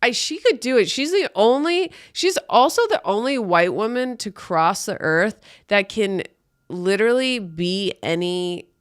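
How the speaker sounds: ordinary delivery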